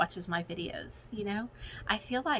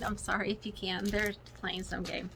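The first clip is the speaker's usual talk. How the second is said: usual speaking voice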